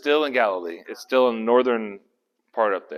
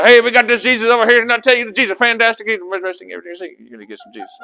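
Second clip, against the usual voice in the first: put-on Galilean country accent; 10 semitones higher, 9 dB louder, much faster than usual. Galilean disciples talking up Jesus, in a rapid country accent. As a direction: rattle it off in a comic backwoods Galilean accent. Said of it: "all them guys talking like this right here".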